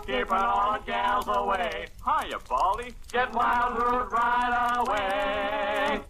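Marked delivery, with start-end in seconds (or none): none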